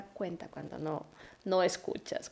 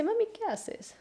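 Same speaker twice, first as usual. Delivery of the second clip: put-on much higher, childlike asking voice; little son's voice; 14 semitones higher than usual. Mother imitating her little son asking what she's doing, high childlike voice. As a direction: pitch up like a puzzled small boy.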